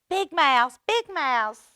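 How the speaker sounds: high, loud cry; high loud lonely call. A narrator's reading. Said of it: "he cried".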